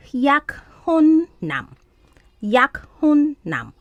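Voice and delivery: yakhunnam drawn out slowly, syllable by syllable; Korean word demonstration. Shown as a demonstration. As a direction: split Yakhunnam into yak, hun, nam. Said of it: "fiancé is called".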